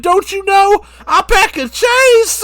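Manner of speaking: slow, high, very loud boast. Acted. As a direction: holler it with stretched-out words. Acting [name] boasting.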